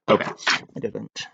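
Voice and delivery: usual speaking voice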